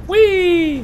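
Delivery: playful whee. Pretend. Jumping down a ledge.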